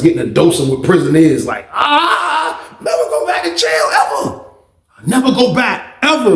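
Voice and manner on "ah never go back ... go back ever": high-pitched, drawn-out mock wail. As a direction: wail it as a joke, horrified by prison. Mock horror at prison life.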